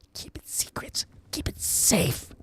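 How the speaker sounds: hushed secretive whisper; conspiratorial whisper. Acted. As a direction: breathe it like a secret. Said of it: "whisper sweet nothings".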